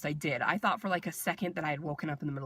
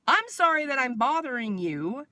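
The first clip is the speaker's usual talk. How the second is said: high, louder mock apology; sarcastic apology; 7 semitones higher, 5 dB louder than usual. Patient answering the curt nurse, sarcastic retort. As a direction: exaggerate the apology with pointed sarcasm.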